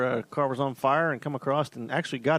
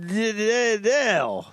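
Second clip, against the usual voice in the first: a playful Southern drawl on "Dale", stretched higher and louder; mock southern accent; 7 semitones higher, 4 dB louder, much slower than usual. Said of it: "My southern accent".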